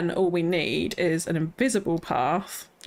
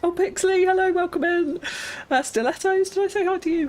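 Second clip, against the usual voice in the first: excited greeting; 11 semitones higher than usual. Real, not acted. Greeting chat viewer warmly.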